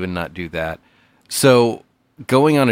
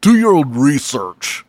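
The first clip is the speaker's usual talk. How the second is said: mock conspiracy guy; 5 semitones higher, 5 dB louder than usual.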